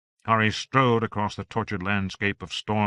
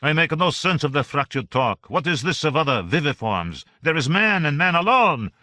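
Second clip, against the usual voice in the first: higher, louder over the wind; Voltaire; 6 semitones higher, 5 dB louder than usual. A narrator's reading. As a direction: philosopher shouting back, baffled. Voltaire answers in storm.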